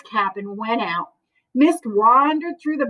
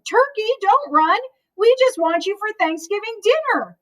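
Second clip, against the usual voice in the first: higher eager call; calling after him; 8 semitones higher than usual. Acted. Moose calling after the running Turkey, high eager call. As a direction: chase him with your voice.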